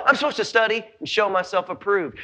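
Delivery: ordinary talk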